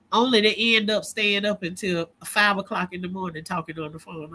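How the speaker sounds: ordinary talk, nothing marked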